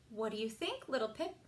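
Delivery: usual speaking voice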